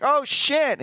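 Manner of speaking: high, loud startled cry; mock shocked yelp. Friend startled by a fake zombie, high mock yelp. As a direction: blurt it as the zombie lunges. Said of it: "he's like".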